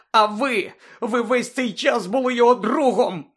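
high, loud furious accusation; voice breaking with anger. A narrator's reading. Harry to Lupin, furious, high loud breaking voice. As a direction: hurl the betrayal at him. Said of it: "Його голос зривався від хвилювання".

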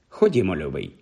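значно нижче й м'якше; заспокой травмованого учня низьким голосом; лагідна розрада. A narrator's reading. Учителька втішає травмованого учня. (лагідне, ніжне звертання)

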